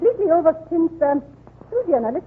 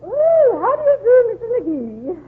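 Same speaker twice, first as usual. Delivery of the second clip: society lady greeting; 8 semitones higher than usual. Acted.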